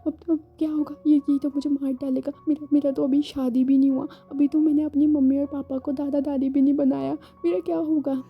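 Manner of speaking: frantic, fearful inner cry; scared voice, fearing for his life; panicked. Acted. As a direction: panic at being caught. Ibo panics, fearing death.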